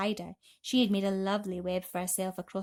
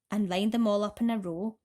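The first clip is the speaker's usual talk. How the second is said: usual speaking voice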